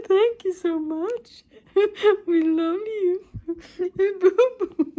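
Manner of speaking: very high, loud tearful voice; real crying over the letter's last words. Real, not acted. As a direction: sob out the sign-off. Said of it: "I'm like, I'm crying".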